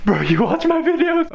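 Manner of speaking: squeaky high-pitched shock, loud; excited disbelief. Retold or imitated. To a fan who loves his videos, loud high shocked squeal. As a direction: leap up in pitch like you can't believe it. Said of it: "And I was like".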